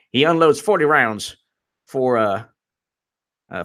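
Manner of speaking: ordinary talk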